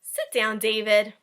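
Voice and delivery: loud, firm teacher command; stern teacher. Acted. Teacher ordering standing David to sit, loud and firm.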